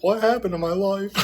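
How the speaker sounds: high, wailing mock sob of a crying father; imitating crying dad. Son imitates his crying dad on his birthday, high mock wail. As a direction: whine it like a heartbroken dad. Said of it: "He's like".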